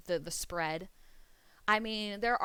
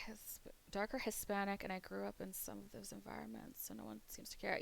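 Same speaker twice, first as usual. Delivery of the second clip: hushed near-whisper aside; 12 dB quieter than usual. Real, not acted. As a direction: drop to a confiding whisper. Drops voice on sensitive topic.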